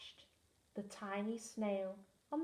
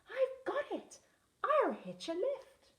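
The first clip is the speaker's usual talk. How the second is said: much higher, bright with a sudden idea; snail's eureka, pitched way up; excited cry; 12 semitones higher, 4 dB louder than usual. A narrator's reading. Tiny snail hits on an idea, excited. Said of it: "then cried".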